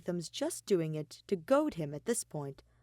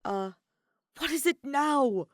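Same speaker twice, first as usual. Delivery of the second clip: higher, louder snapped question; exasperated snap; 8 semitones higher, 7 dB louder than usual. A narrator's reading. Kaveh fed up with Alhaitham's scoff, snapping. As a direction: bark it out, fed up.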